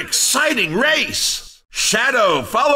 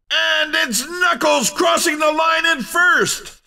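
high-pitched, punchy victory commentary; excited winner call; 5 semitones higher than usual. Acted. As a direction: hype up the race winner. Announcer calls a first place.

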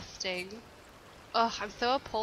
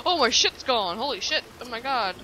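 shocked outburst; 7 dB louder than usual. Real, not acted. Stuff gone, shocked.

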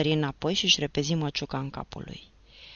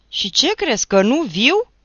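higher, louder challenge; defiant shout; 8 semitones higher, 11 dB louder than usual. Acted. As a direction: shout back as he charges in. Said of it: "strigă el". (strigăt sfidător, tare)

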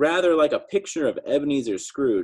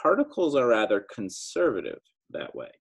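ordinary delivery, nothing marked

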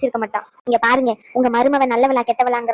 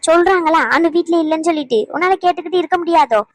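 put-on louder, higher scolding of a child; angry scolding; 4 semitones higher, 4 dB louder than usual. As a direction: drive the kid away harshly. (கோபமாக குழந்தையை திட்டுதல்)

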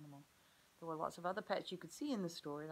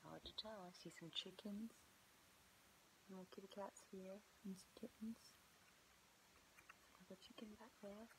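soft unvoiced whisper; 3 semitones higher, 8 dB quieter than usual. Real, not acted. Teacher whispering to children while hunting for Lamb, hushed. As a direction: whisper as if hiding. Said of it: "whispering to children during search".

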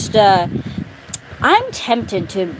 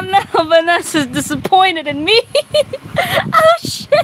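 crying with laughter; 13 semitones higher than usual. Real, not acted. Reacting, laughing hysterically.

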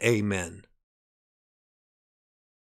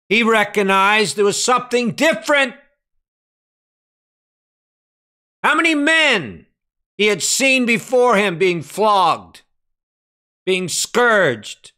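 raised, ringing preacher's delivery; forceful preaching; 9 semitones higher, 6 dB louder than usual. Real, not acted. Preacher telling of Pilate recognizing something different, forceful preaching. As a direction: drive each phrase home loudly to the congregation.

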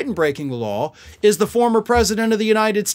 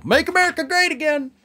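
higher, lilting MAGA sign-off; mock Trump sign-off; 7 semitones higher than usual. Host mocking Trump's post, a high sing-song sign-off. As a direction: chirp "America" up like a slogan. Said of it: "he always sounds off with that".

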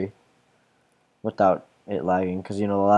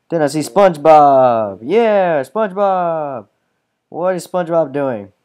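excited; 7 semitones higher, 10 dB louder, slower than usual. Real, not acted. Excited spotting SpongeBob in game.

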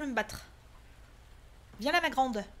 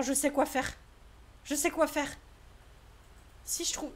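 normal talking voice

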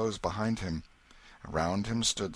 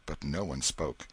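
plain reading voice

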